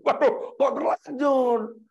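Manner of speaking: mock aghast, stretching the question; dismayed surprise. Father hearing child already confessed, high drawn-out dismay.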